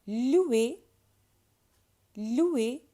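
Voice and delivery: "Louer" as loo-ay with a w glide; little w sound. Shown as a demonstration. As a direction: model "Louer" high and loud, gliding loo into ay. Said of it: "a little w sound".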